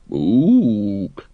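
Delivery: sheepish animal ook. A narrator's reading. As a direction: hoot a small embarrassed ook. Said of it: "said the librarian sheepishly".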